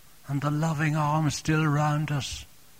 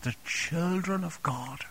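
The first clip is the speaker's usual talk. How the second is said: hushed, unvoiced whisper; 2 semitones higher, 6 dB quieter than usual. Real, not acted. Preacher to congregation about receiving Jesus, whispered. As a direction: breathe it like a secret. Preacher stresses moment of salvation.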